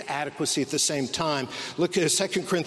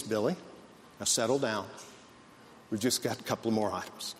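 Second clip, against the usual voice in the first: lower, softer soothing dad voice; calm dad; 6 semitones lower, 7 dB quieter than usual. Retold or imitated. Dad calmly reassuring his son Billy in the store. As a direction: soothe a fussy kid evenly. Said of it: "the dad is as calm as he could be".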